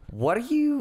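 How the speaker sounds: high, puzzled alarm; high alarmed question. Retold or imitated. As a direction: question the odd bowl. Confronting roommate about bowl.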